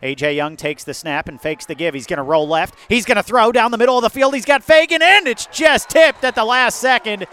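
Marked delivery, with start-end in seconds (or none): 2.9-7.2 high, loud, fast call of the pass; erupting as the ball flies; excited play-by-play. Real, not acted. Deep pass tipped, live call.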